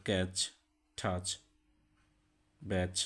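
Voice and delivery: ক্যাচ টাচ ব্যাচ ending in a crisp ch; ending ch said clearly; ch sound examples. Shown as a demonstration. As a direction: clip the ch at the end. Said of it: "উদাহরণে দেখতে পাচ্ছ".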